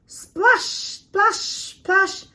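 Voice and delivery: high splashes easing off; three bouncy splashes, fading; playful splash sounds. A narrator's reading. Young penguins diving into the sea, high playful splashes. Sound effect in read-aloud.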